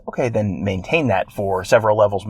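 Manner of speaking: usual speaking voice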